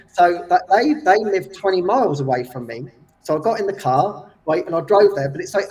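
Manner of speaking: ordinary talk, nothing marked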